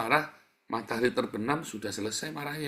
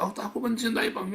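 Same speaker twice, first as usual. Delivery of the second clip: mock protest; 9 semitones higher than usual. Mocks a self-justifying listener.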